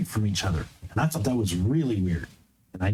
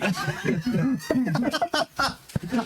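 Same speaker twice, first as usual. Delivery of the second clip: high giggle; 11 semitones higher than usual. Real, not acted. Player laughs at joke.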